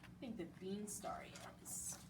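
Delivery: hushed, secretive whisper to the audience. Acted. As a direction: lean in and whisper the guess. Storyteller whispers to listeners.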